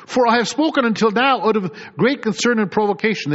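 fervent pulpit reading of Scripture. Acted. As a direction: proclaim the verses to the congregation.

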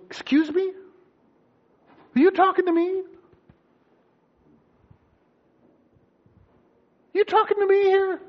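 high, disbelieving questions; incredulous. Acted. The Lord answering a judging believer's prayer, incredulous.

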